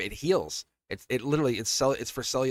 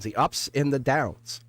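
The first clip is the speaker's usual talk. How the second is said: slow emphatic repeat; slower than usual. Real, not acted.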